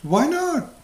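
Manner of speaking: hammed-up demanding why; exasperated wife.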